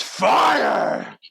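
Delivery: high, booming giant's shout. Acted. Giant grabbing the volunteer's hand, thunderous shout. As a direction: bellow it with huge force. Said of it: "and he shouts".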